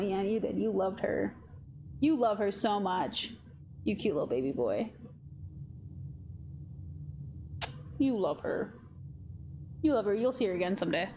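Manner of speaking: soft tender cooing to the cat; pet talk. Real, not acted. Telling her sleeping cat he loved Nala, soft tender coo. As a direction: comfort the cat about his lost friend.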